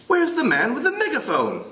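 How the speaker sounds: high British schoolboy voice; British accent. Acted. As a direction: play a piping British boy. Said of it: "try to do it with a British accent".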